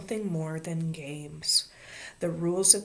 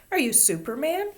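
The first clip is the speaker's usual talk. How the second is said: put-on higher, insistent child's question; 9 semitones higher, 4 dB louder than usual. A narrator's reading. Ramona guessing who Davy is, questioning child. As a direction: quiz him eagerly, pitch jumping up.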